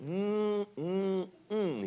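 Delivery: high, hummed mm mm mm of relish; Andy Griffith mmm. Retold or imitated. Daddy showing his son the pizza, high savoring hum. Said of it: "and he did it, the Andy Griffith".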